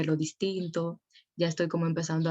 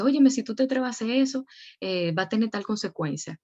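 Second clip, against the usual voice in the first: imitating angry parent; 5 semitones higher than usual. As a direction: threaten like an alarmed parent. Parent scolding the teenager. (imitando a un padre enojado que regaña)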